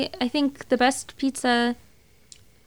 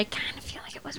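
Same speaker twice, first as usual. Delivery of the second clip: whispering; 3 semitones higher, 16 dB quieter than usual. Real, not acted. Sudden whispered aside.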